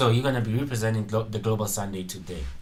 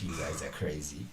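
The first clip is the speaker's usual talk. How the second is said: ordinary talk, nothing marked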